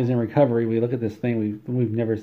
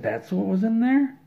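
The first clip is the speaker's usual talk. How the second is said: much higher, incredulous question; astonished patient; 12 semitones higher than usual. Acted. Patient learns what was in his stomach, astonished. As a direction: squeak it up in mock amazement.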